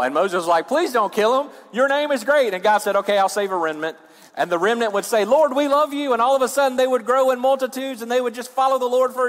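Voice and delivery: high, driving pulpit delivery; forceful preaching. Real, not acted. Preacher retelling Israel's testing to the congregation, high and forceful.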